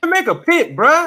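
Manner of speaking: high, loud heckle; loud high-pitched prodding; exasperated urging. Real, not acted. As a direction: shout at a stalling friend to decide. Host heckles stalling co-host.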